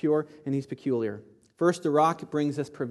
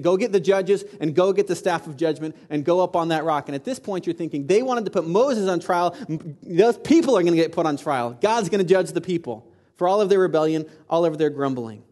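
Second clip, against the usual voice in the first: raised, emphatic pulpit voice; forceful preaching; 3 semitones higher, 6 dB louder than usual. Acted. Preacher warns that God will judge the people, forceful preaching. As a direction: build the case like a preacher pronouncing judgment.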